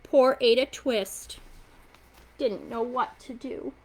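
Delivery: ordinary delivery, nothing marked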